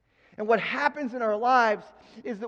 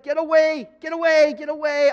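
urgent cry; 5 semitones higher, 7 dB louder than usual. Acted. Peter's frightened refusal.

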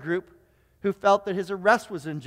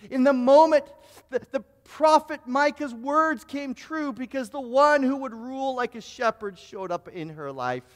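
building sermon climax. Acted.

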